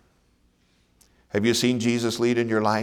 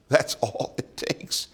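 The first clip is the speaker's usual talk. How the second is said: laughing whisper; 4 semitones higher, 4 dB quieter, slower than usual. Shown as a demonstration. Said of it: "repeated in a laughing whisper".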